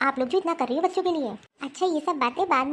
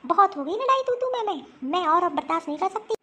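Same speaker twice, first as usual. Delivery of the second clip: ordinary talk, nothing marked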